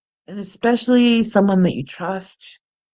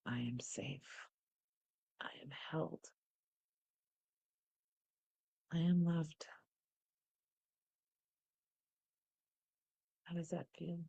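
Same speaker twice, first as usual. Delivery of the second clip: soft, hushed meditation voice with long pauses; guided meditation; 20 dB quieter than usual. Acted. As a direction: guide them gently into calm.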